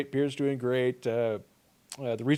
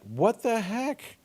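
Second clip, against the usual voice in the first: surprised; 9 semitones higher, much slower than usual. Real, not acted. Chat window suddenly lost.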